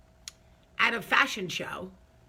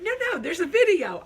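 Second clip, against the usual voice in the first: high, loud imitation of a teaser; mock glee, pitched way up; gleeful teasing; 10 semitones higher, 8 dB louder, faster than usual. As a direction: tease with a laugh, holding it out of reach. Retold gleeful teasing about video.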